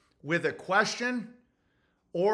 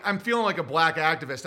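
ordinary talk

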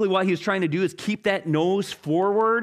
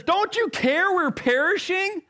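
high, indignant pleading; exasperated plea; 11 semitones higher than usual. Acted. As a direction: accuse him of not caring. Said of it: "I love how Mark says it".

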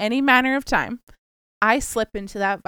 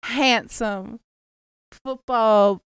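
put-on Southern accent; 7 dB louder than usual. Southern belle matchmaking voice.

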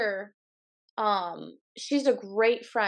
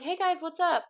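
pitched-up, lightly faked friendly greeting; mock cheery hello; 7 semitones higher than usual. Greeting the class, pitched-up fake-friendly hello. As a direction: act the eager new friend, not overdone. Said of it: "I'd be like".

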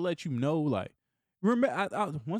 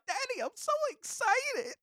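high childlike voice imitating his little son; 3 dB quieter than usual. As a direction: squeak it out like an excited kid. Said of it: "I sound like my son".

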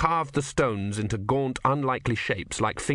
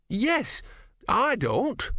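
very high-pitched objection; high, indignant retort; indignant protest; 13 semitones higher, much slower than usual. Acted. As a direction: object firmly, pitched right up. Moral objection in argument.

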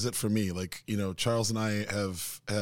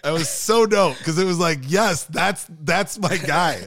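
high, loud, delighted cheer; real excitement; 8 semitones higher, 10 dB louder, slower than usual. Real, not acted. Recalling delight at his award reaction.

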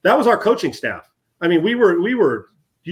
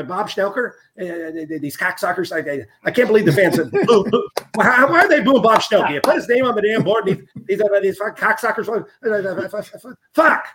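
imitating Bernsey's rant; 3 semitones higher than usual. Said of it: "I do this because it's how Bernsey sounded".

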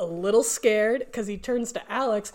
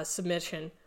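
ordinary talk

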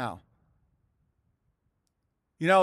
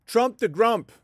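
mock jab at Trump, a touch higher and louder; sing-song playground taunt; lightly voiced schoolyard insult; taunting nickname; 3 semitones higher, 4 dB louder, slower than usual. Shown as a demonstration. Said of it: "It's that easy to do that".

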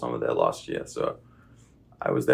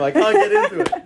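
laughing; 9 dB louder than usual. Real, not acted. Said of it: "laughing at own failed accent".

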